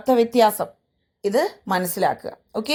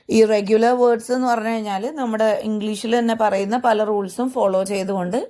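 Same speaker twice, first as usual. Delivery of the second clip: ordinary talk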